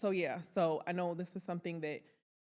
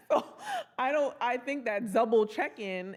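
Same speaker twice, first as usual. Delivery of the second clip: ordinary delivery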